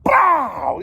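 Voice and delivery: high, loud bang of a door; door banging open. Retold or imitated. Screen door flying open, loud imitated bang. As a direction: bang it out like a door kicked off its hinges. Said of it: "the screen door".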